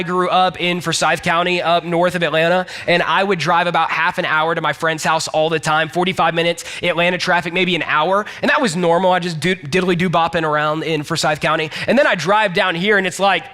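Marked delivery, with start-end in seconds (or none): none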